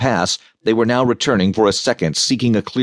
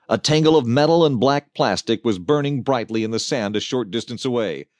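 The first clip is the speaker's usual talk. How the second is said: plain reading voice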